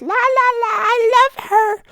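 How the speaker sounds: very high, gleeful squeal; mock an oblivious admirer in a tiny high voice; mocking lovestruck voice. Mocking the guy who keeps pestering a girl, silly high gushing.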